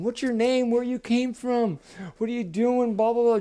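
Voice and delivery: lightly voiced curious frogs, pitched high; high, chattering string of questions; chattering bullfrogs. A narrator's reading. Frogs crowding round the new boy, chattering. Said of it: "they all start chattering at Jordan".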